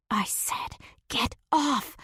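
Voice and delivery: a whisper yell through the teeth with "off" pushed up high. Acted. Still pinned, she hisses at him to get off. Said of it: "I whisper yell through my teeth".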